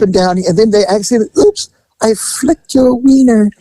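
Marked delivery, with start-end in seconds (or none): 1.4-3.5 high, slow coy "oops" voice; mock innocent masseuse. Masseuse to client after a fake accident, coy and high.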